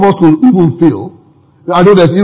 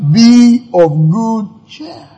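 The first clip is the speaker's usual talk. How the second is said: drawn out slowly, lifted; slow emphatic; 5 semitones higher, much slower than usual. Shown as a demonstration. Preacher savours Jesus' words to disciples, slowly. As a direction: stretch every word of Be of good cheer. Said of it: "repeats Jesus' line slowly".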